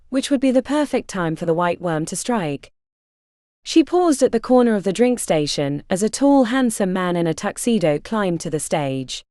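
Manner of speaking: ordinary delivery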